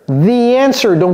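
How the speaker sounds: ordinary talk, nothing marked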